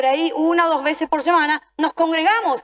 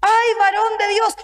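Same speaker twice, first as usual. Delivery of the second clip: mock lament, begging for rescue; wailing plea; 2 semitones higher, slower than usual. Preacher voicing believers who cry out too late, slow wailing plea. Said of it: "ahí recién empezamos a preguntar".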